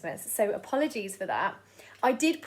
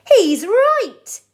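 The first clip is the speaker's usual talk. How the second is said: high, loud eager cry; cheering chimpanzee crowd; excited agreement; 10 semitones higher, 13 dB louder than usual. Acted. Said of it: "they said".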